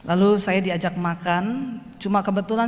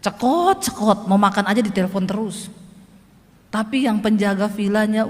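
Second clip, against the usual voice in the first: ordinary talk, nothing marked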